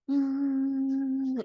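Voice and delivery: steady, drawn-out humming tone; healing hum. Real, not acted. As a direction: hum one long note to clear energy. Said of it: "with some sound".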